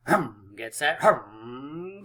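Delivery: playful race-start vroom; drawn-out engine noise before the go; engine revving. Pretend. Mimics engines for a countdown.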